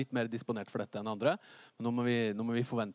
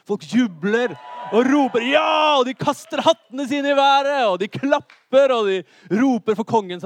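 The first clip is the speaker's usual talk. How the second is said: full-voiced, high shouting over cheers; jubilant crowd shout; 11 semitones higher, 13 dB louder than usual. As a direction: whip the audience into wild cheering. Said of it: "Kom igjen da". (jublende rop for full hals)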